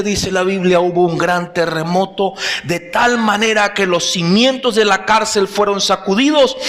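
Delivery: normal talking voice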